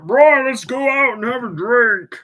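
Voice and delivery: loud, high-pitched Scottish buddy; hammed-up Scottish voice; Scottish accent. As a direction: put on a big Scottish accent for a drinking invite. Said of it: "this is my Scottish accent".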